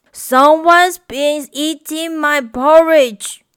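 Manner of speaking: a lightly voiced papa bear, loud and grumpy; grumbling Papa Bear. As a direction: grumble it like a cross father bear. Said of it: "grumbled Papa Bear".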